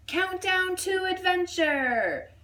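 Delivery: put-on high, slow, eager child voice; boy announcing a game; excited boy. A narrator's reading. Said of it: "Jack yelled".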